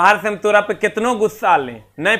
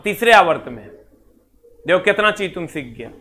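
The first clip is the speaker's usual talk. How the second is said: ordinary delivery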